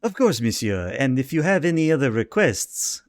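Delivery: a lightly voiced French waiter; poor French accent. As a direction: play the polite waiter with a bad French accent. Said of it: "I get to do a poor French accent".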